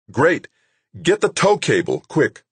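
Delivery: pressing, high-pitched urgency; urgent order. A narrator's reading. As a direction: rap out instructions in an emergency. Rescuer shouting urgent orders.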